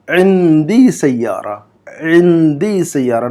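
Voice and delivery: इंदी Saiyaara said twice, slowly and clearly; teacher sounding it out for repetition; slow model reading. Shown as a demonstration. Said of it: "number one का जुमला पढ़िए". (धीमा, साफ़ उच्चारण)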